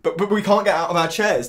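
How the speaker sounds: high, loud, urgent outpouring; impassioned pleading. Acted. Man wanting to meet someone in the gap, impassioned pleading.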